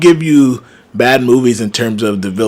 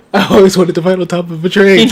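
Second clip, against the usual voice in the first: laughing; 8 semitones higher than usual. Real, not acted. Laughing while voicing Cena.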